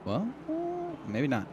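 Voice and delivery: fast building play-by-play; motorsport commentator. Acted. Live race play-by-play.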